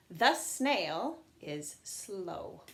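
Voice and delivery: very slow, every word drawn out; slow model sentence. Shown as a demonstration. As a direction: spell the sentence out slowly for the class. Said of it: "I'm gonna say".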